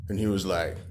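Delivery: ordinary delivery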